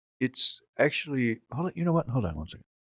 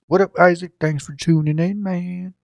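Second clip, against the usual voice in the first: high-pitched, hammed-up Southern accent; mock Southern accent; 9 semitones higher than usual. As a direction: greet them in a bad Southern accent. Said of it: "Gotta talk like that for the Southerns".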